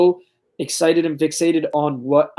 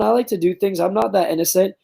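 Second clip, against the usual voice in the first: ordinary talk, nothing marked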